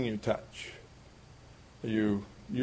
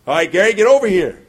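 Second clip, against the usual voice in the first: stern father call; 6 semitones higher, 10 dB louder, faster than usual. Retold or imitated. Father calling son for punishment.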